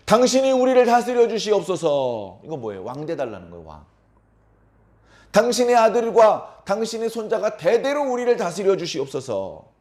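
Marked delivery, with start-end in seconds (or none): 0.1-2.4 grovelling plea. Acted. Elders bow before Gideon asking him to rule, grovelling plea. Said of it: "굽신굽신하면서 뭐라고 합니까?". 5.3-9.7 grovelling plea. Acted. Elders bow before Gideon asking him to rule, grovelling plea. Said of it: "굽신굽신하면서 뭐라고 합니까?".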